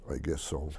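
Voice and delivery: a hint of a modest old man, murmured low and soft; shy mumble. A famous climber quietly admits who he is, shy mumble. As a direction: mumble it shyly, barely lifting the voice. Hillary shy reply again.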